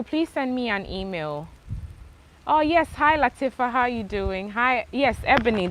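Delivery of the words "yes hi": ordinary talk, nothing marked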